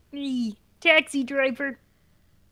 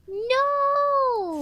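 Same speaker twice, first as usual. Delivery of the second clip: anguished cry; 11 semitones higher, 3 dB louder than usual. Real, not acted. Listener cries out again.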